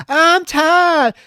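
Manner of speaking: very high, loud mock shriek; mock screaming. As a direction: burst out in hammed-up hysteria. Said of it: "They're both screaming".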